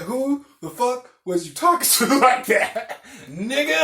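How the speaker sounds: high, loud yell with laughter; retold screaming. Driver confronting mouthy passenger, screaming it in retelling. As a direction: re-enact an outraged yell, cracking up.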